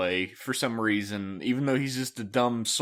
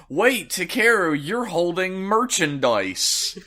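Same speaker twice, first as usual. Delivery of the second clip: high, loud announcer-like pitch; hammy mentor voice; 6 semitones higher, 4 dB louder than usual. Pretend. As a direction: sell the toy like an ad. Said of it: "Urizen comes out and says".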